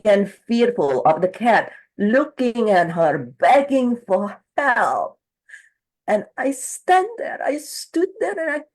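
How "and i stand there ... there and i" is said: high, soft voice of a helpless woman; client's helpless guilt. Retold or imitated. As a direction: relive the moment, hushed and high. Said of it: "she's like".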